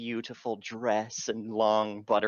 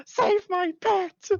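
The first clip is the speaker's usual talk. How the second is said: pleading cry; 7 dB louder, slower than usual. Acted. Player voices a desperate NPC.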